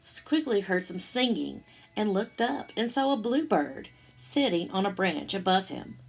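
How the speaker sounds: ordinary delivery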